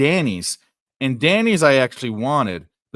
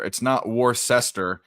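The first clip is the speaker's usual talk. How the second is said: ordinary talk, nothing marked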